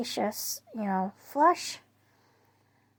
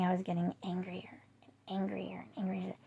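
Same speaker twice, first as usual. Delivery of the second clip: whispered; 2 semitones lower, 8 dB quieter than usual. Real, not acted. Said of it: "unvoiced whisper".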